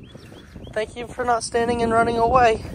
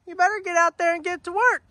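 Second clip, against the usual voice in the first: quoting wife; 8 semitones higher, 4 dB louder than usual. Retold or imitated. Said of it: "Lissa has called this morning and said".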